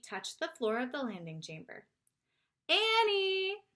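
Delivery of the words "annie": higher, louder call across the room. A narrator's reading. Jack calls out looking for his missing sister. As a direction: call out for her. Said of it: "he called".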